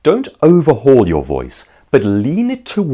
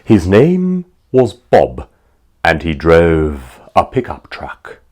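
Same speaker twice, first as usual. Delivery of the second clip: slow, lowered fairy-tale telling; storybook narration; 4 semitones lower, slower than usual. Acted. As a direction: read it like a bedtime story.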